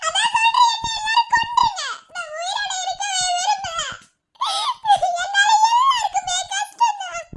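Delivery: high sobbing wail; wailing despair. Acted. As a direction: cry out in utter despair. Wailing, begging to be killed. (உயர்ந்த குரலில் அழுகை)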